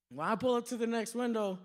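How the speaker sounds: normal talking voice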